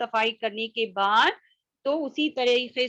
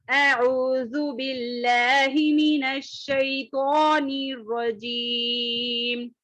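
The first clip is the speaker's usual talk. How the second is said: slow, drawn-out recitation; Quran recitation; 2 semitones higher, much slower than usual. Shown as a demonstration. Teacher reciting for the class, slow and melodic. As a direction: chant it slowly and devoutly.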